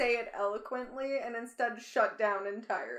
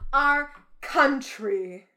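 Our country said slow and louder, each word stressed; mock drama teacher stress; 2 semitones higher, 9 dB louder, much slower than usual. Mocking the prime minister's speeches, slow overstressed Our country. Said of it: "he just emphasizes weird words".

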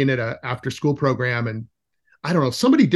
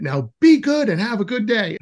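lightly imitated leader, higher pitch; school leader; 7 semitones higher than usual. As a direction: send the kids off with a raised, teacherly voice. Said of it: "that person went".

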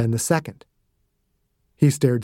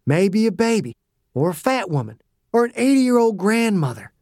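higher, sneering tough-guy goading; cocky taunting old soldier; 6 semitones higher than usual. Acted. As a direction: dig the knife in, mocking him. Dew goads Perry.

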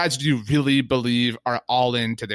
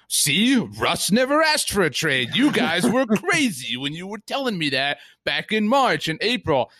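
higher voice, lightly mocking a smug quarterback; mock Russell Wilson; 6 semitones higher than usual. Fans mocking Russ with a lightly raised smug voice. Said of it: "Seahawks or Packers fans that will be like".

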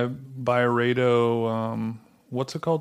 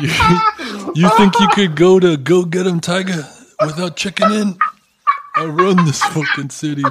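loud, higher mock-menacing challenge; mock city boss; 6 semitones higher, 5 dB louder than usual. City boss to visitor who runs it his way, mock threat. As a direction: play the indignant boss who owns the town.

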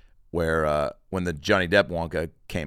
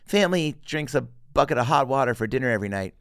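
put-on higher, innocent little boy; earnest kid voice; 5 semitones higher, 4 dB louder than usual. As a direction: say it proudly, oblivious. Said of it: "He's like".

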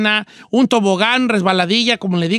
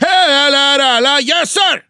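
higher, hammed-up salute chant; saluting soldier; 3 semitones higher, 4 dB louder, slower than usual. Don Cheto demonstrates the salute emoji, mock soldier. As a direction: chant it like a playful recruit. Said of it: "así como un saludo".

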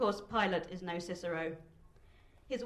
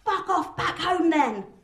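loud, high, drawn-out jeer; slow cheeky heckle; heckler's shout; 8 semitones higher, 10 dB louder, slower than usual. A narrator's reading. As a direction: yell it mockingly from the crowd. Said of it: "shouts one wag in the crowd".

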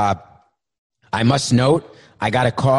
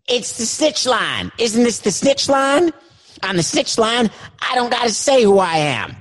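high, indignant voice; indignant caller; 5 semitones higher than usual. Acted.

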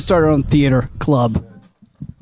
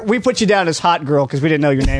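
ordinary delivery

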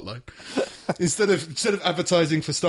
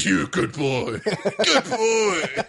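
hammed-up doggy praise, rising high; praising a dog; 5 semitones higher, 3 dB louder, much slower than usual. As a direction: gush over the dog in a silly voice.

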